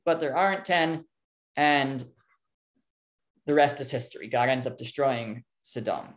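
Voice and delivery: ordinary delivery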